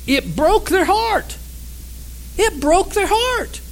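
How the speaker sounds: high, drawn-out lament; slow, high-pitched heartbreak; anguished preaching. Retold or imitated. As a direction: repeat it as a pained cry, pitched high without shouting. Preacher dwells on the people's heartbreak.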